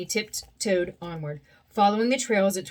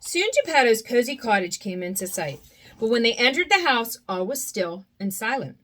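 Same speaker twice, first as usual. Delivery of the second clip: usual speaking voice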